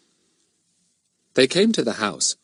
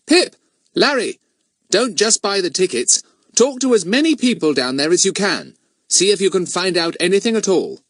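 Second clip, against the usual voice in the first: high, raised call to two friends; 10 semitones higher, 3 dB louder than usual. A narrator's reading. Boy calls to his two friends as they leave, calling out. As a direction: call out brightly, then give instructions. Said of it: "He called to the other two".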